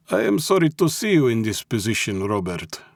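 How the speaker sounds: high-pitched, clipped Russian mob boss; clipped Russian accent. A narrator's reading. Mob boss pities his captive, clipped Russian accent. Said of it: "said Malensky in his clipped Russian accent".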